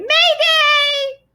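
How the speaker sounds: very high, loud bird shriek; screaming bird. A narrator's reading. The wiffle bird, startled from trance, screaming. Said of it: "she screamed".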